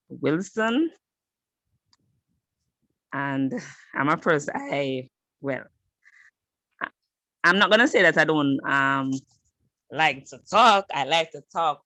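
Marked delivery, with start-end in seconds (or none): 4.6-6.9 halting, stuttered start. Real, not acted. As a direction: stammer over the first words. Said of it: "I stutter".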